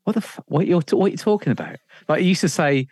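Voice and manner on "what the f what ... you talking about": higher, bemused what-are-you-on-about; mock the nonsense analogy, higher; baffled retort. Presenter mocking boss's analogy, baffled higher retort. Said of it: "He'd be like".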